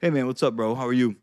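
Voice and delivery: low, neutral-faced greeting; low-pitched, unwelcoming hello; unsmiling greeting. Shown as a demonstration. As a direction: greet a buddy without any smile. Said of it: "As opposed to".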